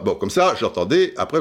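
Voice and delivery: ordinary talk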